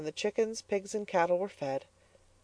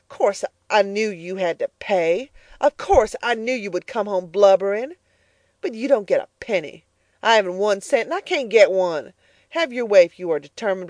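louder, relentless harsh scolding; scornful tirade; 3 semitones higher, 7 dB louder than usual. Acted. Mrs Comstock lecturing Elnora about paying, louder. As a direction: hammer every pay home.

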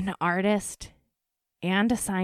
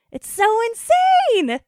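high, loud, drawn-out squeal; squealing excitement; 10 dB louder, slower than usual. Real, not acted. Gushing about her artwork in the video, squealing. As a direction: let it burst out of you, slowly.

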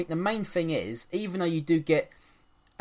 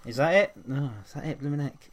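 disappointed. Real, not acted. Host hearing there is no more news, disappointed. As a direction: sound underwhelmed, dropping away on the grumble. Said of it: "You sound disappointed".

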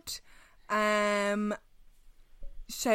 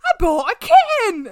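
much higher, louder, laughing; excited reveal; 12 semitones higher, 11 dB louder than usual. Retold or imitated. Telling girlfriend about the kitten, higher and laughing. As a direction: blurt the good news with glee.

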